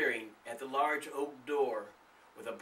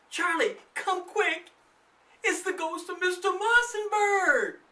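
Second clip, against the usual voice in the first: much higher, louder panicked cry; terrified exclaim; 8 dB louder than usual. A narrator's reading. Darlene seeing the ghost, terrified cry. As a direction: scream for Charlie to come. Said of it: "Darlene exclaimed".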